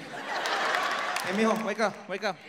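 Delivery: Mexican mom. Retold or imitated. Mexican mom waking the kid against dad, loud mock rouse. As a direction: drag the kid into the fight. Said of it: "Mexican chicks bring the kid in".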